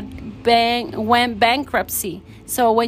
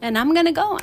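fervent insistence; 7 semitones higher than usual. Retold or imitated. Insists to doubting husband.

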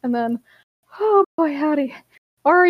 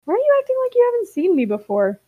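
higher, quick puzzled question; confused; 9 semitones higher, faster than usual. A narrator's reading. Grape questions Pager's odd behavior, confused. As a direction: ask it thoroughly bewildered. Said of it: "looks really confused and asks".

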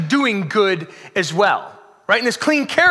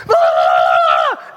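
loud low mock scream of panic; panicked yell; 7 dB louder than usual. Pastor acting his own meltdown, loud yell. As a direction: blow up in a hammed-up yell. Freaking out, wife interrupts.

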